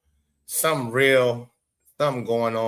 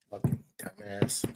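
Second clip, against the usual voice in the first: low aside, muttered under the breath; soft, scornful grumble; muttered insult; 8 semitones lower, 11 dB quieter than usual. Real, not acted. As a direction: mutter it to yourself in disgust. Insult after shoe argument.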